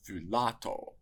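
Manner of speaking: thulato with the middle syllable la punched; thulato, accented on its second-to-last syllable, la; stress on the penult. Shown as a demonstration. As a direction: lean hard on la in thulato. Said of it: "with an acute accent on the penult".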